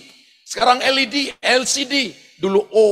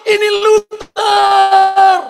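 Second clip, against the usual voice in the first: high, full-voiced holler, last word held very long; repeated boyhood plane call; yelling to the pilot; 11 semitones higher, 6 dB louder, much slower than usual. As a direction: shout it up to the sky. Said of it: "saya selalu berkali-kali saya teriak".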